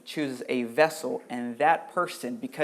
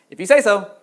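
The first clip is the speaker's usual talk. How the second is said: "If you say so" higher and louder, doubting; sceptical "if you say so"; 5 semitones higher, 8 dB louder than usual. Retold or imitated. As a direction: sound unconvinced, brushing it off. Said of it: "this is what people say".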